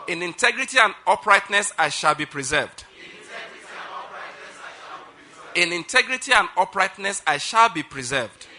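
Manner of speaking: loud, measured proclamation with pauses; preaching declaration. Acted. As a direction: declare it line by line for a congregation to repeat. Leads congregation in declaration.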